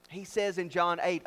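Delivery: usual speaking voice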